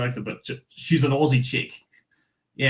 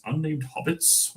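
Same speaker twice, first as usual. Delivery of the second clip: slow, incredulous repeat of unnamed hobbits; softer, baffled "Unnamed hobbits?" with a rising tune; puzzled echo; 4 semitones higher, 7 dB quieter, slower than usual. Real, not acted. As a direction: question the phrase "Unnamed hobbits" in slow disbelief. Puzzled echo of text just read.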